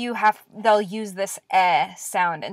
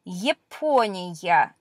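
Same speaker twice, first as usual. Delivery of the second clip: slow reading; 3 semitones lower, much slower than usual. Shown as a demonstration. Teacher reading a word slowly.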